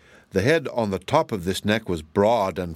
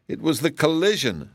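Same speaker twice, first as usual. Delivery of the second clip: mildly regretful, pitched higher; 7 semitones higher than usual. A narrator's reading. Tin Woodman explaining his dents, mildly regretful. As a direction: own up with a touch of regret. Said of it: "said the Tin Woodman regretfully".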